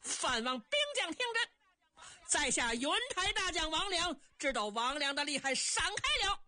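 high-pitched, ringing warrior's challenge; battle shout. Acted. General shouts at enemy troops while cutting through them, high-pitched. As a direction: announce yourself and order the enemy aside. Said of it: "随杀随嚷".

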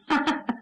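brief acted laugh; short villain chuckle. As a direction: chuckle on meeting your superior. Villain greets his commander. (干笑几声)

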